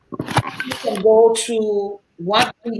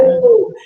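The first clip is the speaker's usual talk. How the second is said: high, loud "woo" of approval; excited whoop; 14 semitones higher, 6 dB louder than usual. Real, not acted. Cheering the case for therapy, excited whoop. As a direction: whoop it up for the point just made.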